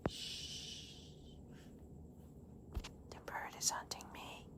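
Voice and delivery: hushed whisper, barely voiced; hiding whisper. Worm hiding from the bird, frightened whisper. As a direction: whisper like the hunter is near.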